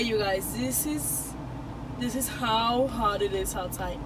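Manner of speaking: usual speaking voice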